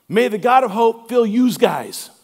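a light New York style "yous guys"; mock Northeastern read of the verse, slow; Northeast accent. Pastor imitating a Northeast congregation, Northeast accent. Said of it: "it might sound like this".

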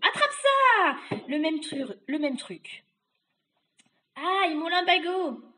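high, drawn-out witch cries; witch beating away. Acted. Witch thrashing an old coat, loud high cries. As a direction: play the witch thrashing, then aching.

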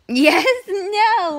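laughing through yes, no. Real, not acted. Mom laughing at her own joke, laughing. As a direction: crack up at the joke. Joking about being lazy.

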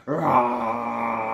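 long mock roar with a laugh in it; big playful "raaah"; playful shark roar. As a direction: roar like a story-time shark. Shark roar after reading the title.

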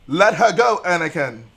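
hammed-up urgent film command; commanding plea. Streamer tells Anakin to release her, loud command.

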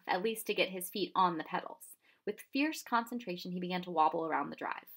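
ordinary delivery

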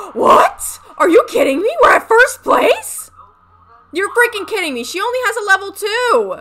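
high, loud shrieks of disbelief; can't believe they're winning, yelling; excited disbelief. Real, not acted. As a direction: scream in thrilled shock. Shocked her horse is winning.

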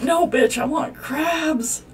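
high, loud, drawn-out whiny demand; mock character demand. Gamer voicing a customer demanding crabs, high and whiny. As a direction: ham up a stubborn customer.

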